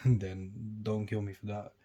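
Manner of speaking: ordinary delivery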